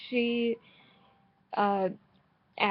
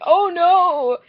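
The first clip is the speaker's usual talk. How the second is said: high, loud hammed-up oh no; overacted dismay at a sad villager; mock dismay; 8 semitones higher, 12 dB louder than usual. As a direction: gasp it out in mock horror. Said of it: "I'm like".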